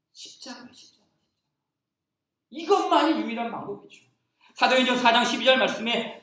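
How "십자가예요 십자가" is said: whispered, breathless key word; sudden hush after the climax. Acted. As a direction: drop to a whisper for 십자가. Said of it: "dramatic hushed repeat".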